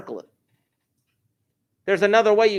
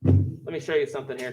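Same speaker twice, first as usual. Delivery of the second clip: usual speaking voice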